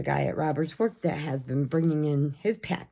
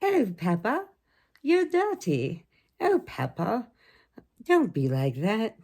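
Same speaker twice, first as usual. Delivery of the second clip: high, sing-song British cartoon mum; cartoon mother voice; 4 semitones higher than usual. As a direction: coo at Peppa like the cartoon. Imitating Peppa Pig show.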